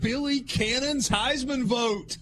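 delighted stunned host; amazed disbelief. Real, not acted. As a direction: savour each word in disbelief.

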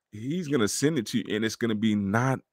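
normal talking voice

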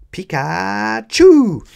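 high, loud, drawn-out creature cry; Pikachu battle cry. A narrator's reading. Pikachu launching a Thunderbolt, high roaring cry. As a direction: roar it out slowly, building to a loud last syllable. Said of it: "his Pokémon roared".

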